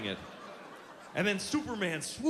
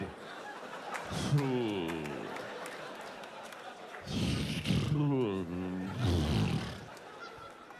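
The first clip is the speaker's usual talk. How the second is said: long, low slack-lipped "hoo" oozing out; drawn-out lazy low ooze of sound; mock slid-out "who"; 11 semitones lower than usual. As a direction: imitate words leaking out of a slack mouth. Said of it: "he's just secreting words out".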